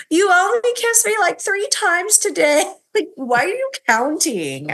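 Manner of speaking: high whiny wife. Retold or imitated. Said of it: "she's like".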